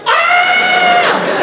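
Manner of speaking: very high, held shriek; mock girl's scream. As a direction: squeal like a panicked schoolgirl. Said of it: "girls were like this".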